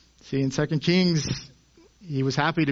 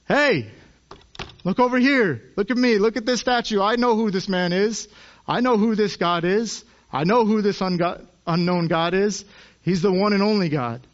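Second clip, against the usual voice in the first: Paul yelling; 4 semitones higher than usual. Acted. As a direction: shout it out to the crowd. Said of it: "he yells out".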